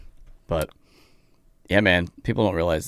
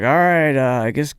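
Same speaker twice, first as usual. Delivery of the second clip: mock dismissive host voice, higher; lightly voiced rude host, pitched up; mocking the bad host; 9 semitones higher, 5 dB louder than usual. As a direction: sneer the intro like a rude emcee. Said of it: "he's like".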